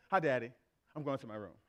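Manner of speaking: low, dismissive imitation of a daughter; rejecting daughter. Twelve-year-old daughter brushing off her father, mock indifference. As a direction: mimic a child brushing off Dad, dropping low. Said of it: "she's rejecting me now".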